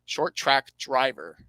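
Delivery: slow, deliberate redo of the phrase. Real, not acted. Podcaster correcting his rushed words, slowed right down. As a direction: space out each word carefully. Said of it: "I'm speaking too fast".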